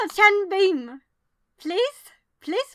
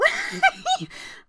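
high squeaky giggle; giddy laugh. Real, not acted. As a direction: giggle with delight at a lucky pull. Laughing at a lucky pull.

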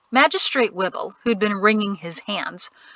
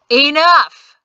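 loud furious roar; 6 semitones higher, 10 dB louder than usual. A narrator's reading. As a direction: bellow it to silence the crowd. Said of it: "he roared".

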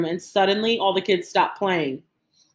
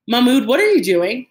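louder, higher, rising question; astonished question; 5 semitones higher, 5 dB louder than usual. A narrator's reading. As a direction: call out in disbelief to your brother. Boy questions his brother's walkout.

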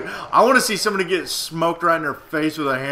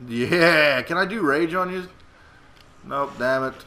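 usual speaking voice

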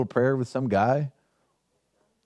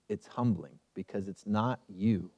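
much softer and lower, slower, "humbling" stressed; much softer emphasis on humbling; 9 semitones lower, 10 dB quieter than usual. Acted. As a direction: hush down and slow on "It's humbling". Preacher drops further.

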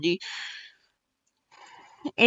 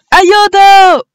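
high, loud panicked cry; screamed help; 11 semitones higher, 11 dB louder than usual. Acted. Crew member fleeing the alien creature, screaming for help. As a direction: scream for help at the top of your lungs. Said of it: "urlò il Ciano".

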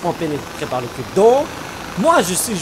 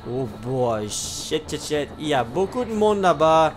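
hushed swearing; 4 semitones lower, 5 dB quieter than usual. Real, not acted. Spotted many enemies.

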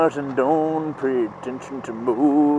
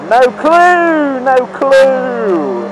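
loud, high taunting shout; angry yell; 10 semitones higher, 9 dB louder, much slower than usual. Real, not acted. Rider yelling at a clueless driver, loud taunt.

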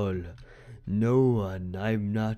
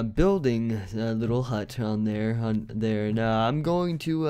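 usual speaking voice

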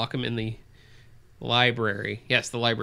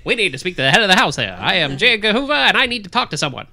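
loud, fast, high newsreel announcer; old newsreel voice; 6 semitones higher, 6 dB louder, faster than usual. Acted. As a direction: bark it like a 1940s newsreel. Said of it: "how you guys do that voice".

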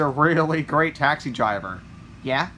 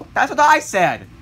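loud, higher-pitched shout; exasperated retort; 7 semitones higher, 7 dB louder than usual. Acted. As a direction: fire back, exasperated.

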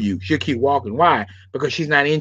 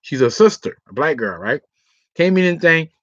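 ordinary delivery

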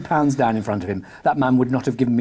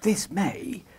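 whispered; 5 semitones higher, 6 dB quieter than usual. Acted. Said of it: "whispered Holmes".

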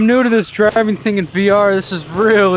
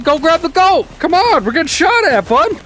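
higher, urgent yell at the teammate; urgent high call; 9 semitones higher than usual. Real, not acted. Under fire, urging his teammate to grab the goat, pitched-up call. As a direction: push him to hurry, pitched up.

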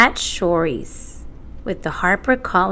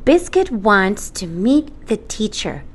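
ordinary delivery

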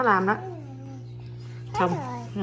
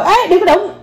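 sharp warning; 9 semitones higher than usual. Real, not acted. Warning child not to touch.

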